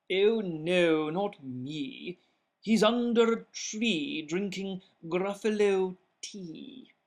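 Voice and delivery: mole voice. Acted.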